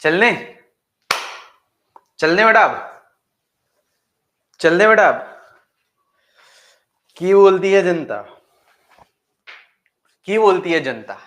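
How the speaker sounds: loud, high playful call to the audience. Real, not acted. Teacher calling out to his online class, loud and playful. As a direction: shout it out to the crowd.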